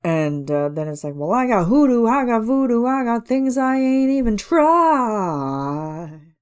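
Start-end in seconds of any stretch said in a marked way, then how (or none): 1.1-6.3 high, slow, sly singing villain; bargaining Facilier. Retold or imitated. Reviewer imitating the singer's Facilier, high and sly. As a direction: coax it like a smooth voodoo man selling a deal. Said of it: "interpreting the bargaining feel of Facilier".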